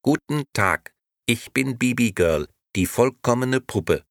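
high, squawky doll talking as if through a phone; a tinny talking doll, high and bright. A narrator's reading. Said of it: "die etwas quäkend klang, als käme sie aus einem Telefon".

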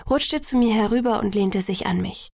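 ordinary delivery

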